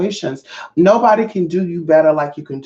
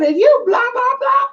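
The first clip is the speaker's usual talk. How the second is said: much higher, mock-sweet "are you"; high mock question. To the ex, a high mock-sweet question. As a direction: put on a squeaky, sugary sound. Confronting the ex.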